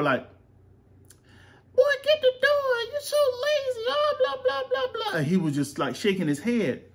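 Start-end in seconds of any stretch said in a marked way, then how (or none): 1.8-5.2 put-on very high squeaky kid voice teasing; shrill-pitched mimicry of teasing kids; mocking classmates' voice. Dad mimics classmates in a very high voice. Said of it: "Enough with the voices".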